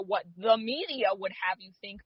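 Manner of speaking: normal talking voice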